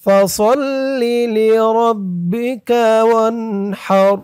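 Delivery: slow melodic tajwid chant; measured Quran recitation; Quranic recitation. Shown as a demonstration. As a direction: recite slowly with a drawn-out melody. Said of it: "আমার সাথে বলুন".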